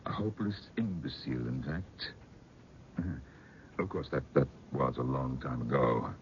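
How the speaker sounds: soft, grave, dropping almost to a whisper; hushed telling. Acted. Uncle telling guests of the madness, hushed. Uncle tells grim family tale.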